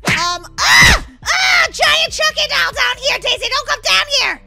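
high screaming warning; acted panic. [name] to Daisy on seeing giant doll, acted panic. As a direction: shriek and warn your friend away.

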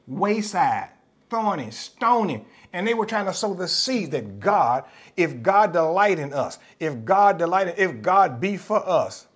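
loud, slow, pounding preacher cadence; fiery preaching. Acted. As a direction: drive each "God" home like a hammer. Preaches at full force.

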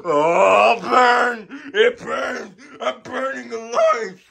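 high pained screams of burning. Acted. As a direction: scream as flames take you.

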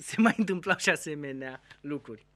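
ordinary talk, nothing marked

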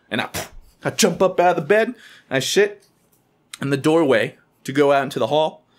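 ordinary talk, nothing marked

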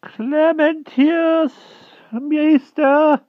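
very high, slow rustic country voice; a frightened old hedgehog begging humbly; timid hedgehog voice. A narrator's reading. Hedgehog begging the Wart for mercy, high timid rustic voice.

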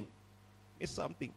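whispered begging, acted out; patient's voice giving out to a whisper; mock feeble plea. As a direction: beg weakly under your breath, hammed-up.

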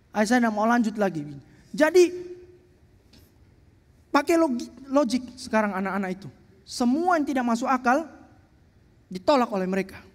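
ordinary talk

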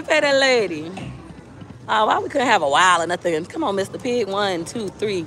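ordinary delivery

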